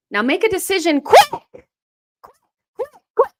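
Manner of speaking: high, rising cries of quick; urgent urging. Real, not acted. Urging player during attack.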